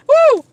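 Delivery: high-pitched exasperated whoop; exasperated woo. Real, not acted. Venting about buying Lysol, exasperated woo.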